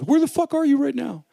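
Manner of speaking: high, loud imitation of an irate dad; outraged dad impression, shrieked high; yelling dad. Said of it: "I got yelled at when I told my dad".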